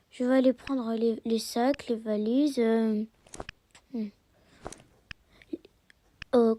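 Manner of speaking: usual speaking voice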